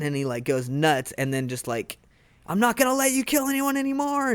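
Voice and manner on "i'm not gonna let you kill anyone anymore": much higher, strained angry cry; furious vow. Retold or imitated. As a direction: hurl the promise at the villain. Goku goes nuts at Frieza.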